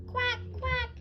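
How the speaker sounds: high squeaky duck quacks; silly high quack quack. Acted. Dog makes duck sound.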